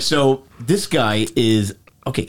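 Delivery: ordinary delivery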